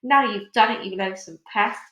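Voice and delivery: usual speaking voice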